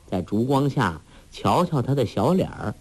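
ordinary delivery

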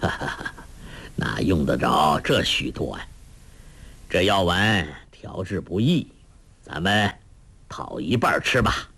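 low chuckling elder; old monk, laughing. A narrator's reading. Monk declining so many pills, low and amused. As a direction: laugh first, then demur in a low old man's voice. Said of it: "一灯笑道".

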